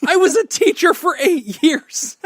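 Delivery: high, loud, laughing yell; laughing shout. Real, not acted. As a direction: blurt it out while cracking up. Mock outrage, laughing hard.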